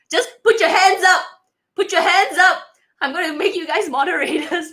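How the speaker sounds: high, loud laughing call. Real, not acted. Asking viewers to volunteer as moderators, laughing.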